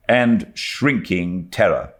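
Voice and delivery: usual speaking voice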